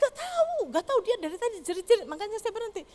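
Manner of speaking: high, fast, flustered mother's voice. Retold or imitated. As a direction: rattle it off like a panicked mom. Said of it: "Mamanya bilang". (ibu panik bicara cepat dengan suara tinggi)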